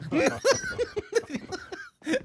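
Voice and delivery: high, breathless burst of laughter; shaky laugh after a scare. Real, not acted. Gamer laughing at his own scare, high nervous laugh. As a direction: laugh off the fright.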